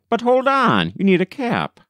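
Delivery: high, eager call-out; excited cry. Acted. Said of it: "cried Smith".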